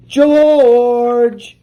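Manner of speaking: calling out. Acted. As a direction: call him from a distance. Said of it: "he heard someone call".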